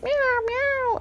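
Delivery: high, drawn-out mewing; imitated cat meow. Excited cat waking her at night, high meowing. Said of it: "So he's like".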